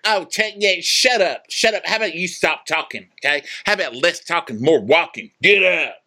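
high, dismissive mock bro voice. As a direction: sneer it out high and bossy. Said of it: "He's like".

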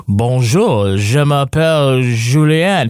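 slow, measured Paris French; mock the textbook Paris accent; imitating a Parisian speaker. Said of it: "People think the French in Canada talk like they do in Paris".

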